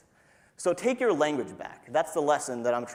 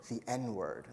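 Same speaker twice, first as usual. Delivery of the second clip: much softer hushed aside; mock whisper; 4 semitones lower, 13 dB quieter than usual. Mocking the timid, saying the euphemism in a hushed mock whisper. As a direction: hush it like a secret. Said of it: "they'll whisper it".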